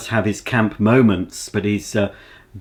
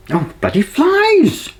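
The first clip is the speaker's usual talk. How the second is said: exasperated; 4 dB louder, slower than usual. Real, not acted. Swatting flies off crumpets.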